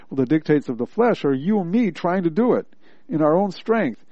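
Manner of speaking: ordinary delivery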